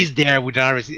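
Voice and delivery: usual speaking voice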